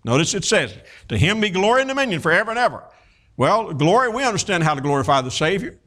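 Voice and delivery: normal talking voice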